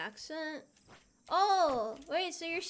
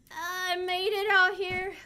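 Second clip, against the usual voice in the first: proud triumph; 5 semitones higher, 8 dB louder, slower than usual. Real, not acted. Triumph in game.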